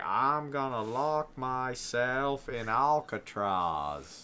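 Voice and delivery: slow, drawled Piper-Jimmy Stewart mix; Piper impression. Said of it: "Your Piper impersonation is a mix between Piper and Jimmy Stewart".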